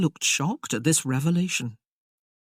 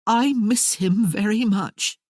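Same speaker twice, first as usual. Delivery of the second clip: wavering voice; 6 semitones higher, 3 dB louder, slower than usual. Acted. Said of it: "her voice wavered".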